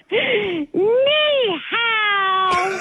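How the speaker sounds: high, drawn-out call on request; big greeting. Pretend. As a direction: sing it out long and high, amused. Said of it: "one more big ni hao".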